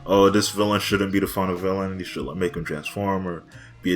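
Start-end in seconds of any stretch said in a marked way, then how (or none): none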